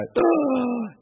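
high, long imitated gasp; mock gasp for breath. Patient struggling to answer the nurse, mock gasping. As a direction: heave in air before managing an answer. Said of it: "lying there gasping for breath".

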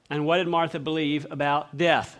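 ordinary delivery

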